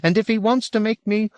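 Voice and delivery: high-pitched ranting; agitated protest. A narrator's reading. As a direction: keep protesting high, heedless of interruption. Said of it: "The man stopped his protests".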